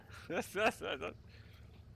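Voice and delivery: a sudden high burst of laughter. Real, not acted. Streamer laughing at chat about trips home, high laugh. Laughing at own travel habits.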